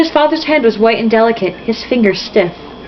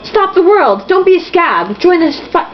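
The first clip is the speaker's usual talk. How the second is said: rallying cry; 8 semitones higher than usual. Acted. David rallying the newsies.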